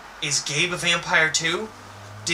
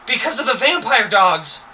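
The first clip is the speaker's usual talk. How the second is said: higher and louder cry of panic; 5 semitones higher, 6 dB louder than usual. A narrator's reading. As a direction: call out in alarm, higher. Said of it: "you cry".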